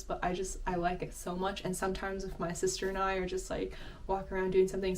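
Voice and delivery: ordinary delivery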